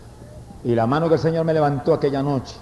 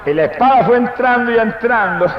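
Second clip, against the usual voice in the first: raised voice swelling into a long, high praise cry; full-force preaching; 6 semitones higher, 3 dB louder than usual. Real, not acted. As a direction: preach it out to the congregation. (prédica exaltada, a plena voz)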